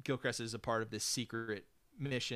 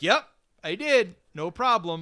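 higher, louder breezy cheer; mock breezy hacker; 6 semitones higher, 10 dB louder than usual. Mocking the hacker answering the phone, higher louder breezy cheer. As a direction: toss it off like it was nothing.

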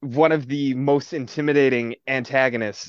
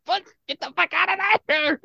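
very high-pitched mock panic; hammed-up alarm, strained and high; panicked reaction. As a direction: squeal a frantic warning. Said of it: "making me be like".

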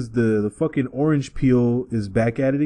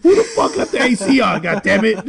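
irritable outburst; 11 semitones higher than usual. Retold or imitated. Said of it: "everything just makes you irritable".

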